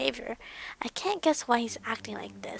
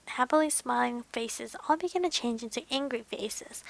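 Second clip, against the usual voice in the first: ordinary delivery, nothing marked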